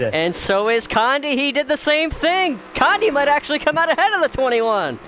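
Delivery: high, loud excited call; sudden burst of race excitement. Real, not acted. Rival car coming out ahead.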